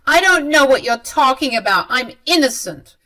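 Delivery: mother-in-law insists she's innocent; insistent denial. Acted.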